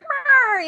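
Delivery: high, playful imitated meow; cute little mock cat noise; adorable meow. Star clowns for the women serving him, adorable. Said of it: "being adorable".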